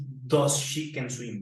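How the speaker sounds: slow, marked error; drawn-out mistake; slow wrong example. Shown as a demonstration. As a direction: say the wrong form slowly. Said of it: "No decimos".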